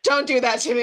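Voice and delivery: mock protest. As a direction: imitate the client squirming under the question. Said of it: "And she's like".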